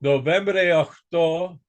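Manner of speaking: a bad Greek accent, shown for the class. Shown as a demonstration. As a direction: call sign letters in a self-mocking Greek accent. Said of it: "my Greek accent sounds something like this".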